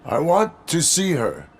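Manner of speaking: high-pitched, urgent insistence; distraught demand. Acted. Distraught cop demands to see partner.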